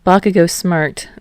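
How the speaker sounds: plain reading voice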